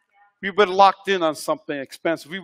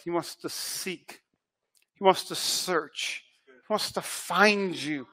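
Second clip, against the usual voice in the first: hushed preaching; 9 dB quieter, slower than usual. Real, not acted. Preacher drops to intimate tone.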